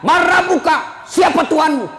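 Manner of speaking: grave angel questioning the deceased; angel shouting question. Acted. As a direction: boom the question at the dead man. Angel interrogates the dead man.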